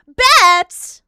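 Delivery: sudden loud, high burst; exaggerated yelled agreement; mock eager shout. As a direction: blurt it out far too eagerly. Mock reply to mommy voice request.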